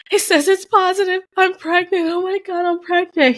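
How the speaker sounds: high-pitched, shaky-with-shock retold panic; panicked news-breaking. Retold panic at positive test.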